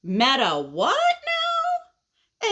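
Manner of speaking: high, stretched-out baffled question; baffled caterpillar. Acted. Caterpillar hears a huge new word, baffled. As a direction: drag it out high in disbelief. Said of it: "So the impatient caterpillar says".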